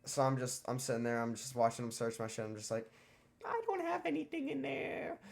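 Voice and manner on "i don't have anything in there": much higher, fake-innocent squeak; mock innocent. Teen watching his bag searched, mock innocent high voice. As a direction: play it sweet and innocent.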